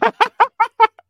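loud, high burst of laughter; big real cackle of amusement. Real, not acted. Listener laughs at parakeet joke.

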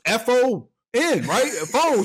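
put-on high, slow, unsure kid voice spelling; mock small boy guessing letters; imitated little kid. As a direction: spell it out like a lost child.

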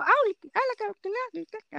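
high, sing-song mock Finnish syllables. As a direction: parody Finnish speech, hitting every first syllable. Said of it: "van a decir todo".

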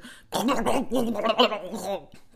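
rapid garbled rumbling noise; imitated mic noise. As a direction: imitate mic handling noise from car speakers. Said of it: "all you hear is".

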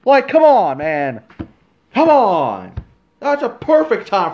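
high, loud "come on"; exasperated yell. Real, not acted. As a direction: throw your hands up at him. Exasperated outburst at Roman.

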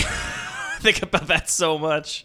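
slow, laughing, leaning on think; half laughing at himself; laughing emphasis. Real, not acted. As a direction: drag it out through a laugh.

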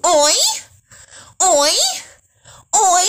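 loud, high "Oi, oi, oi" moans; mock moan. Imitating a porn actress's moans for her viewers, high and loud. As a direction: ham up the porn actress's moaning. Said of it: "That was it, I promise you".

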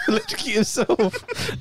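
real laughter breaking up the sentence; laughing while telling. Real, not acted. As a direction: dissolve into laughter as you tell it. Retells death, cracking up.

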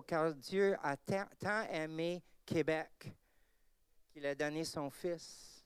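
French verse quote. Shown as a demonstration. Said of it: "and I was using this".

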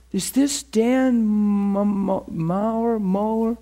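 high, slow and unsure over the name; hesitant caller getting the name wrong; caller stumbling. Retold or imitated. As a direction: fumble the unfamiliar surname.

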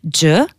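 dje said as hard "djeu"; English j plus eu for dje; Albanian XH sound. Shown as a demonstration. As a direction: dje as "juh". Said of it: "ça fait".

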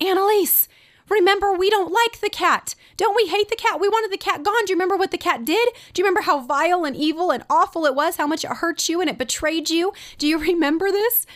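high-pitched mock-incredulous mother; shocked, retold. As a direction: act out a shocked mom pleading up at her daughter. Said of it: "I'm actually shocked she's doing this".

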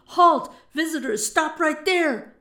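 loud, high alarm shout; shouted order. A narrator's reading. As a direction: shout them to a halt.

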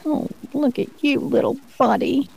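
high, sing-song coo at a little animal. Real, not acted. Host coos at a comedian's little cow, sing-song baby talk. Cooing at a calf in a video.

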